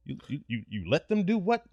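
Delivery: stammer, then shoot up high on the question; stunned, high-pitched questioning; mock disbelief. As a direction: act out incredulous reaction to gossip. Acts out reaction to friend's news.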